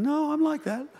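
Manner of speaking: high, soft imitation of a mum's thought; small high-pitched mock objection; protesting mum. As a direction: pipe up quietly as an objecting mum. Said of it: "some of you mums are sitting here thinking".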